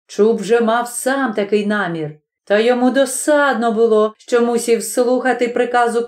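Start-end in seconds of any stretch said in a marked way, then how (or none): none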